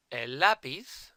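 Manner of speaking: lápiz stressed loud on LÁ; lápiz with a strong LÁ and lighter final syllable; stress on second-to-last syllable. Shown as a demonstration. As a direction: say lápiz slowly, punching the first syllable. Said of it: "my accent was on the second".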